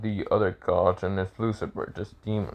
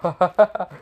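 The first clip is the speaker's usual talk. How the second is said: a high burst of mocking laughter; gods laughing; 8 semitones higher, 5 dB louder than usual. A narrator's reading. The gods laughing at Naruto, loud high laughter. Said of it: "the gods were just laughing".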